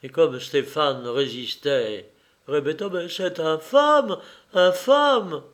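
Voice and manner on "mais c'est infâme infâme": higher, slowed indignant outburst; infâme thrown out louder the second time; flaring up. A narrator's reading. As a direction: explode in outrage. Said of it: "il s'emporta".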